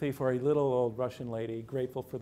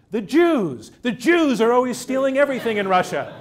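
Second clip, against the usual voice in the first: much higher and louder, blurting out accusations; the sweet old lady suddenly snaps; old Russian lady accusing, loud and high; angry old woman; 10 semitones higher, 11 dB louder than usual. Retold or imitated. Old lady's sudden bigoted outburst.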